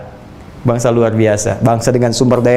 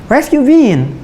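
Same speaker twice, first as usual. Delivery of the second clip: lightly put-on English accent, a bit higher; 9 semitones higher, slower than usual. Preacher mimics Indonesians speaking English, light English accent. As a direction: imitate an Indonesian speaking English like a native, playfully. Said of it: "kayak orang Inggris".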